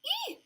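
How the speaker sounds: a sudden, very high squeal of delight. Real, not acted. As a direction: squeal like you just saw something adorable. Squeals at cute nail.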